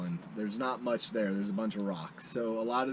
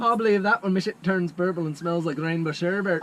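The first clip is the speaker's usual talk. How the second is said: put-on loud, higher Scottish accent, leprechaun-like; 7 semitones higher, 9 dB louder than usual. David asked for a Scottish accent, loud hammed-up brogue. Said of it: "That sounds like a leprechaun".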